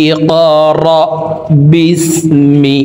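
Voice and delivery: ইকরা with a bounced qalqala on the ক, বিসমি drawn slowly; word-by-word recitation. Shown as a demonstration. Teacher breaking the words apart for students to repeat, slowly. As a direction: say ইকরা with a jolt on ক, then stretch বিসমি. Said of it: "শব্দে শব্দে ভেঙে ভেঙে অক্ষরে অক্ষরে বলে দিচ্ছি".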